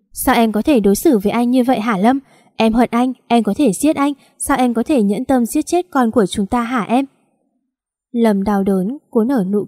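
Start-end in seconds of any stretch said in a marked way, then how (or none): none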